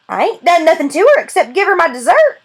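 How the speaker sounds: put-on high, aggrieved old-man protest; defensive grandfather. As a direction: protest your innocence like a hurt grandpa.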